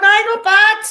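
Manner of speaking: loud and high, calling through a wall; called out loud. Retold or imitated. Calling back to the prisoner through the cell wall, loud and high. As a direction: shout it back next door.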